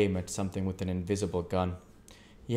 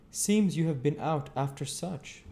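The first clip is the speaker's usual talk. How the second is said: the stranger; 8 semitones higher than usual. A narrator's reading. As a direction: voice the odd stranger, curious. Stranger asks Joe.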